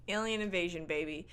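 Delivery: normal talking voice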